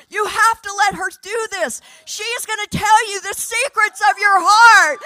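put-on excited young man insisting to his friend; bursting with excitement. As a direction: urge him on, nearly exploding with joy. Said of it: "is about to explode".

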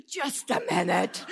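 gasping, slow, loud plea; mock breathlessness. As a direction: play winded on the treadmill. Acts out talking while on treadmill.

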